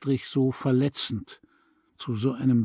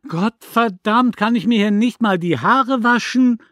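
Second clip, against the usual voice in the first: high, loud indignant yell; irritated neighbour yelling; angry shout; 9 semitones higher, 7 dB louder than usual. A narrator's reading. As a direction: bellow from the doorway, dripping wet. Neighbour interrupted, furious.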